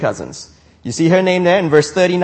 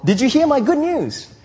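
"Did you hear my good news?" pitched much higher, eager; mock excited boast; 9 semitones higher than usual. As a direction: playfully act out an eager cousin's brag. Imagined Elizabeth boasting to Mary.

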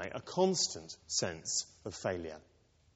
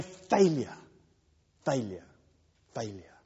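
fading failure; 2 semitones lower than usual. Retold or imitated. The chain calling after Peter, each failure fading away. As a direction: let the word die away like a fading echo.

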